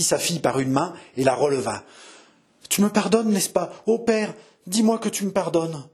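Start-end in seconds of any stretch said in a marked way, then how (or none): 2.7-5.8 high, urgent plea; imploring. Acted. Daughter begging her father to forgive her, imploring. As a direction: beg desperately to be forgiven.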